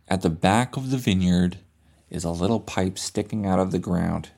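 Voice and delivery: ordinary delivery, nothing marked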